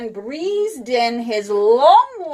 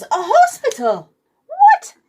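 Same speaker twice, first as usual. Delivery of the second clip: surprised; 13 semitones higher, 6 dB louder than usual. A narrator's reading. Boy realizes he's in hospital.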